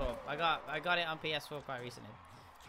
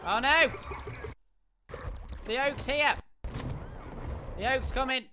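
high, loud panicked yelling; being chased; 4 semitones higher, 5 dB louder than usual. Real, not acted. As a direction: yell like something is right behind you. Fleeing an enemy in game.

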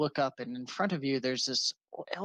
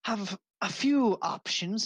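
put-on old wizard; 10 semitones higher, 3 dB louder, much slower than usual. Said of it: "turns around and says".

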